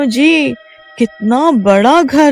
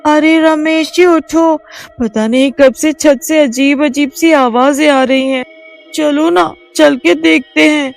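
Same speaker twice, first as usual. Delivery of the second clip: scared wife waking husband; nervous urging; 9 semitones higher than usual. A narrator's reading. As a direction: urge him to come look. Reema wakes husband.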